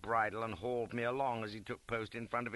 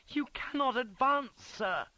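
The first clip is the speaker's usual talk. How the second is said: high, louder shouted protest; shouting; 13 semitones higher, 5 dB louder than usual. A narrator's reading. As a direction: shout to stop your superior. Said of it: "shouts Beatson again".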